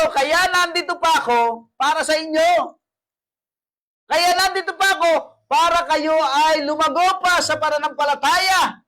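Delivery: loud, high, slowed sermon cry; full-force preaching. Acted. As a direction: preach it out to the congregation.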